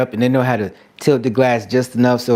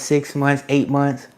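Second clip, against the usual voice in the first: ordinary talk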